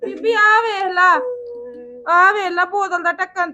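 loud, high, indignant call; angry complaint. Acted. Mother angrily calls grandmother to see the broken bottle. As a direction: demand she come look.

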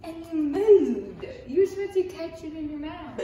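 high laughing protest. Real, not acted. Laughing egg fight.

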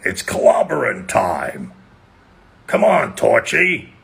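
rough rock-hero battle cry, slow then rising; The Thing. Acted. Rock hero rallying his teammate, The Thing voice. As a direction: roar it like a cartoon rock brute. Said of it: "happened to be the rock thing".

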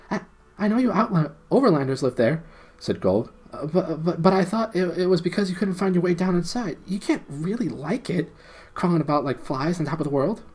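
high, eager little gnome pleading. Acted. Gnome Golg explaining to the prince and friends, high and eager. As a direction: squeak it out like a small underground gnome.

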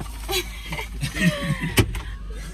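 high falling burst of laughter. Real, not acted. Laughing at the men's teasing, high laugh.

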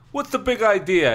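loud, high, indignant demand; acted indignant outburst; angry demand. A narrator's reading. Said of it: "I demanded angrily".

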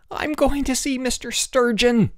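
high-pitched raging threat; enraged. A narrator's reading. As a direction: rage it in a high, furious cry. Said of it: "Elmer raged".

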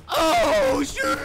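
high, loud startled scream; panicked scream. Real, not acted. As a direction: scream as if ambushed. Gets chased by enemies.

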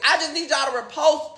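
normal talking voice

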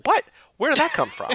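imitating the manager, pitch jumping high in disbelief; a baffled, high squeak of a question; confused surprise. Coworker hears a strange word, high baffled surprise. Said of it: "and he was just like".

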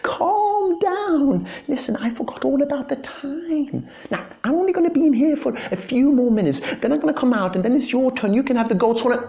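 very high, fast squeaky dog voice; dog hushing cat. Acted. Dog hurriedly soothes his friend at the door, squeaky and fast. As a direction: rattle it off high and quick.

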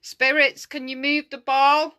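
loud, high call to spirits. Real, not acted. Ghost hunter asking spirits to move the ball, calling out.

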